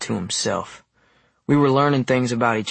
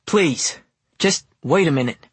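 higher, urgent pleading; raised-voice plea; hollered apology; 5 semitones higher, 3 dB louder than usual. A narrator's reading. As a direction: call after them, begging them to stop. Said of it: "I hollered".

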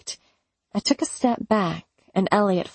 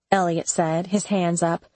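usual speaking voice

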